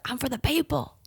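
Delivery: high, fired-up rally voice; mock young activist. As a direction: play a riled-up kid activist. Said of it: "So I grew up to be like".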